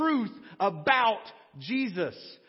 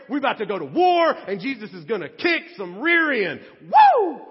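shouting, whooping crowd ready for war; hyped crowd cheer; 7 semitones higher, 10 dB louder than usual. Acted. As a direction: whip up the crowd like a rowdy fan. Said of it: "for them going".

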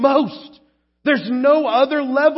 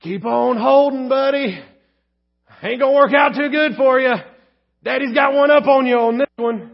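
teasing dad; 4 semitones higher, 5 dB louder than usual. Retold or imitated. Father teasing breath-holding child.

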